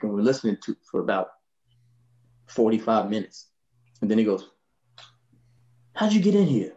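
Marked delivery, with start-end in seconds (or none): 5.9-6.7 high raspy Miles, suddenly suspicious; [name] voice. Retold or imitated. Miles asking the bassist how he got in, high rasp. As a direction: ask it like Miles just noticed you.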